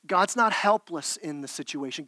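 ordinary delivery

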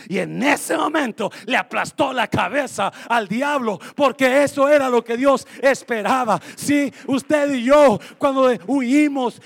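loud, driving sermon shout; preaching at full force. Acted. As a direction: preach it hard to the crowd.

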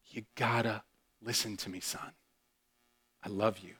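low, very soft, loving plea; hushed, heartfelt reassurance; tender fatherly voice. Retold or imitated. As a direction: speak gently while holding your son. Father embracing his lost son.

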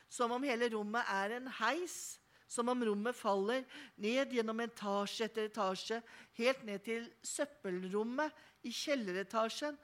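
usual speaking voice

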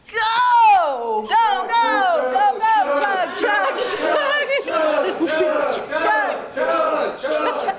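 loud rowdy cheering; cheering shout. Real, not acted. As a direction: shout them on to chug. Cheering on chugging contest.

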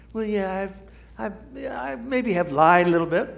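hedging sinner, voice lifted; sheepish excuse. Acted. Preacher voicing a sinner who half admits lying. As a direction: play the squirming excuse-maker.

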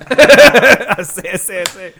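laughing cartoon rooster imitation; stuttery mock-Southern bluster through a laugh; Foghorn Leghorn. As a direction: play a blustering rooster, amused. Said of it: "I need Foghorn Leghorn".

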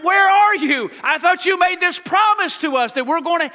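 high, loud cry up to heaven; shouting to heaven. Retold or imitated. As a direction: call out to God, high and loud. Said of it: "Abraham is shouting to heaven".

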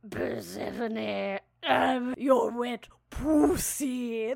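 slow, drawn-out mock seductive god voice; mock Hades voice. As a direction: ham up a slow sultry villain. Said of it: "the Hades voice is like".

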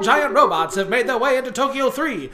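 loud, high breaking news read; hammy TV anchor announcement. Pretend. Mock breaking news report.

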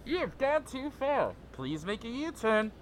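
robotic navigation announcer; GPS voice. Acted. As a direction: read it like a sat-nav. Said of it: "you wanna do the G P S voice?".

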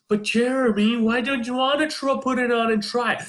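high pleading mom voice, mock; mock-pleading mom coaxing her son; pleading mother. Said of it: "she's pleading with him".

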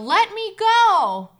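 loud, high, drawn-out narrated scream; screaming yell. A narrator's reading. Child dragged by cold hands, screaming to be freed. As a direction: yell it slowly, pitch soaring. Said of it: "I scream and yell".

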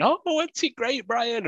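high, slow, gushing presenter voice; excitable presenter. Retold or imitated. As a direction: gush over it like a bubbly host. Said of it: "Just shouting at Dean".

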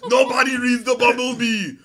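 loud, booming Arnold impression. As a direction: bark it like a movie action hero. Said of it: "so do your Arnold".

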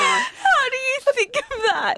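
high, teary words through real laughter; asking while helpless with laughter; crying with laughter. Real, not acted. To her co-host, crying with real laughter.

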